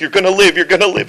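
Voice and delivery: high, loud acted urgency; urgent reassurance. Friend to dying general, high urgent insistence. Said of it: "he's like".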